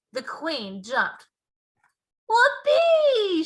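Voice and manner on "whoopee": very high, loud whoop; a giant's delighted yell; joyful shout. A narrator's reading. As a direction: whoop it out with glee. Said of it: "shouted the B F G".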